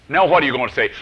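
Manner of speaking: usual speaking voice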